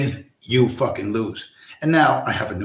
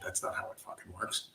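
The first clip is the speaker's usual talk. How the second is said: a breathless muttered aside, much softer; 5 semitones lower, 21 dB quieter than usual. Real, not acted. Grumbling to viewers, whispered much softer.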